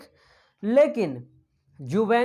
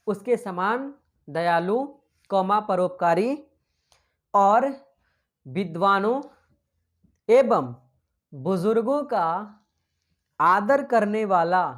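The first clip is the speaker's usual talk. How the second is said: slow, word-by-word dictation with pauses; dictating a quote to the class. Shown as a demonstration. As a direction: read it out slowly for students to write. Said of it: "statement लिखिए".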